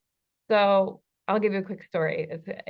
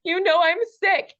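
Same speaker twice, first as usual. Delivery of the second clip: put-on high, louder whiny little-boy voice; hurt little boy; 9 semitones higher, 7 dB louder than usual. Imitating her sick husband's inner hurt boy, high and whiny. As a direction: play the sick, wounded child asking for pity. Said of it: "hurt, uh, little boy inside of him just be like".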